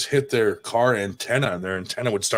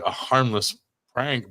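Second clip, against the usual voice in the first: normal talking voice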